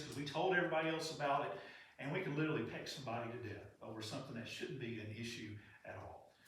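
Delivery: normal talking voice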